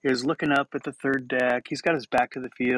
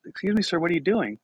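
tag-along attendant; 7 semitones higher than usual. Retold or imitated. Voices the stadium attendant confronting him.

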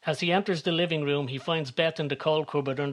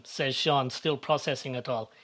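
plain reading voice

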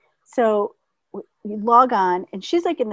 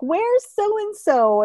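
relatives; 10 semitones higher, much slower than usual. Retold or imitated. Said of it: "they're like".

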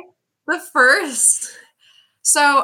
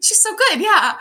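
much higher, mock approving voice; imitating hospital staff; 10 semitones higher, slower than usual. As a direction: mimic staff's easy approval, pitched high.

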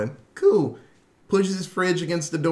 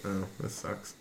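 muttered gripe; 8 semitones lower, 14 dB quieter than usual. Retold or imitated. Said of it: "it's like".

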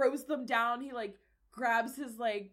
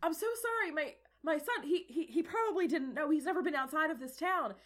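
higher, stammering father; panicked apology; 5 semitones higher, 4 dB louder than usual. Acted. Father apologises to princess for his son, flustered. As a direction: trip over your apology, pitched up. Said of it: "he's, like, freaking the fuck out".

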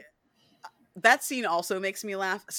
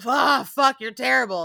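louder, higher fed-up groan; Louis fed up with Lestat, louder; exasperated Louis; 5 semitones higher, 7 dB louder than usual. Retold or imitated. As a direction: snap at him in exasperation. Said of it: "Louis goes".

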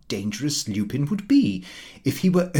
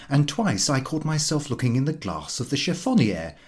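ordinary delivery, nothing marked

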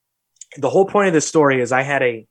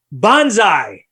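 mock war cry, louder and higher; retold battle cry; 6 semitones higher, 5 dB louder than usual. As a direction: call it out like a kid leaping. Retelling kid's jump cry.

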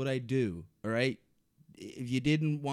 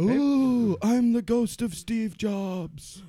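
ghost voice; 6 semitones higher, 8 dB louder, much slower than usual. Pretend. Announces self as ghost.

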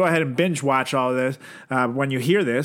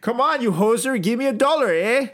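1 mock Canadian bully; 6 semitones higher than usual. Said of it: "Canadian accents".

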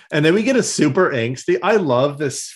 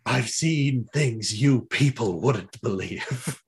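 dramatic movie quote; 4 dB quieter, slower than usual. Retold or imitated. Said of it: "he's like".

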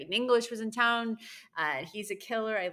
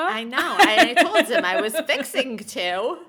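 louder, laughing through every phrase; 5 semitones higher, 10 dB louder, slower than usual. Real, not acted. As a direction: laugh while telling it, slowing at the end.

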